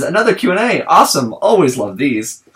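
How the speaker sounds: dry, mocking fan message read; sarcastic reading. Shown as a demonstration. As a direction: read it with an ironic edge. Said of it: "I read that sarcastically".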